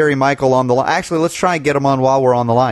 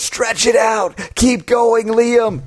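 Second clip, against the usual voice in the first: high, urgent off-stage prompting; awards-show cue giver; 8 semitones higher, much slower than usual. Acted. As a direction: urge the speaker to keep stalling. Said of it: "when they do in the Academy Awards".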